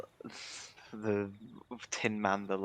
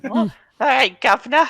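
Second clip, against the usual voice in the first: loud, very high mock character voice; Flopsy; 13 semitones higher, 11 dB louder than usual. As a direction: call it out in a hammed-up impression. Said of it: "my Flopsy impression".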